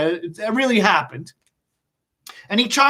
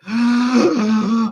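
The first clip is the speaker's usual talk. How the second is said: gasp. Acted. Dramatic gasp at fire.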